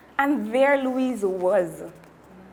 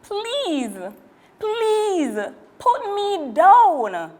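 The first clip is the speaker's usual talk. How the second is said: much higher, louder, slow begging; desperate pleading; 9 semitones higher, 6 dB louder, much slower than usual. Acted. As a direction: plead for your life. Girl begging monster.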